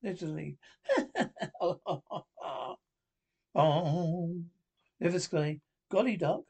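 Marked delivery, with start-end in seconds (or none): 0.9-2.7 a giddy, slightly unhinged laugh. A narrator's reading. Brewmaster gloating over his growing creature, nutty laugh. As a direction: laugh gaily, a little mad. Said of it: "laughs gaily and a little nuttily".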